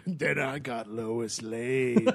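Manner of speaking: hammed-up low Batman gloating; slow low mock Batman.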